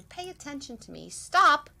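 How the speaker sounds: body's warning, ending in a sharp loud STOP; sudden loud stop. Acted. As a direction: yell stop like a body at its limit. Body voiced as a warning.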